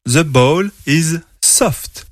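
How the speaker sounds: put-on slow model sentence for children; teacher modelling English slowly. Shown as a demonstration. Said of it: "En anglais".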